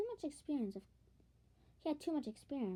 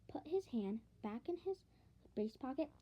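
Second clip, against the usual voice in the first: plain reading voice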